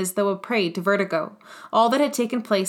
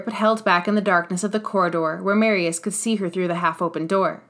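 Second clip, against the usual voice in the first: ordinary delivery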